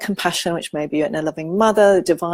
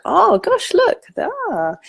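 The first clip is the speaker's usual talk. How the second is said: mock dismay; 13 semitones higher, much slower than usual. Noticing harsh inner words.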